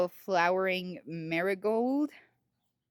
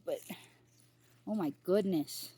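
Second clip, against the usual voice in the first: ordinary talk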